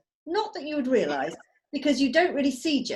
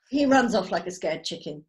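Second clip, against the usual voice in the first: ordinary delivery, nothing marked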